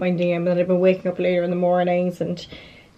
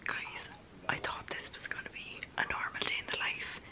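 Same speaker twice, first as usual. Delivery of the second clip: lower, breathy, urgent whisper; excited whisper; 14 semitones lower, 17 dB quieter than usual. Real, not acted. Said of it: "I'm whispering because it's late".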